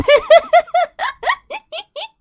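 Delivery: squealing real laughter; high giggle fit. Real, not acted. Laughing at the ogre line.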